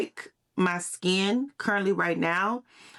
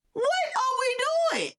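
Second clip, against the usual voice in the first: shouted high and slow, cracking with disbelief; exasperated cry; slower than usual. Real, not acted. Vlogger crying out at her audience in a high, slow, exasperated shout. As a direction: wail it out at the absurdity. Exasperated rant about money.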